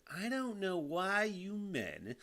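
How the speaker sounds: high, drawn-out mock-mom voice; imitating mom. Son quoting his mom's complaint, mock-mother voice. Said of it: "She said".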